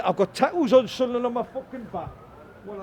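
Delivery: ordinary delivery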